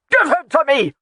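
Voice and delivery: high, loud shouted demand. A narrator's reading. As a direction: shout it, grabbing for the child. The laird demands his son.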